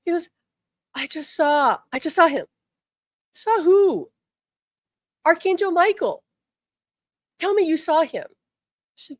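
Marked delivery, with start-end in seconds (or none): 1.0-8.3 pitched up, urgent and wondering; awed insistence. Retold or imitated. Husband urging his wife, high and awed. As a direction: tell her breathlessly what he saw.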